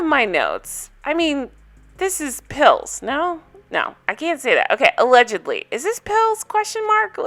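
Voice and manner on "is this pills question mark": mock suspicious. Real, not acted. Gossiping about a cast member.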